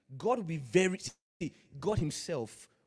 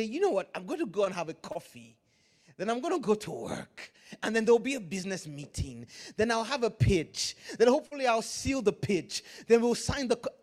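higher, bouncy run through the day's plans; eager planner; 6 semitones higher, 7 dB louder than usual. Acted. Preacher mimicking a believer planning the workday, mock eager. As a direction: reel off your plans brightly, pitch up. Acts out a complacent person's daily plans.

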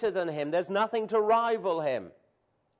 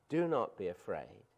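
soft reassurance; 10 semitones lower, 7 dB quieter than usual. Acted. Repeated scripture refrain.

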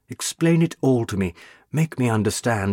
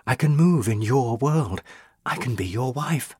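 ordinary delivery, nothing marked

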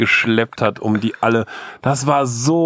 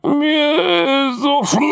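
put-on whiny sick kid; 12 semitones higher, slower than usual. Sick child voiced in story.

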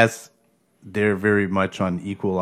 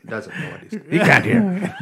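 laughing; 8 semitones higher than usual. Retold or imitated. Tester muttering about him.

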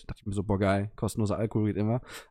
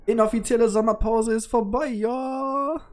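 excited shout; 12 semitones higher, 5 dB louder than usual. Acted. Hyped return after summer break.

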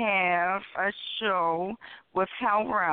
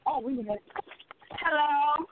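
calling out away from the phone; high, slow, half off-mic; turning away from phone; 8 semitones higher, much slower than usual. Real, not acted. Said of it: "I hear you talking on the phone".

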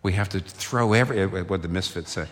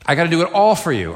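louder, emphatic repeat; 7 semitones higher, 8 dB louder, faster than usual. Shown as a demonstration. Preacher voicing Jesus to the congregation, loud emphatic repeat. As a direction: raise the voice higher and hit "all" hard.

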